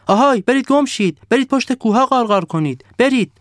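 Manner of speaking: high, loud snowman shouting people off; a pompous snowman barking orders; bossy snowman. Acted. The snowman shooing the crows away, loud and high.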